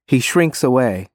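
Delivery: plain reading voice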